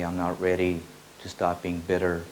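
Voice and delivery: usual speaking voice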